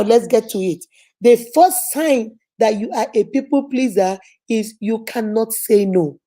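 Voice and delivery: ordinary talk, nothing marked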